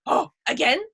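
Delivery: a dog begging for another round; hopeful dog. Retold or imitated. Dog asking his owner to do it again, eager high voice. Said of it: "he's just looking at me like".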